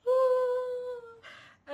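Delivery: high, loud pained cry; mock labor scream. On the balcony, screaming through the contraction: a loud high cry. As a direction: let out a scream from the gut. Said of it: "screaming my soul out".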